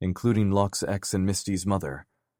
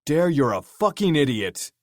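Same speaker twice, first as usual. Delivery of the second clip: loud, higher scolding; 8 semitones higher, 5 dB louder than usual. Acted. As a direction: call him an idiot across the bar. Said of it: "Fox said loudly".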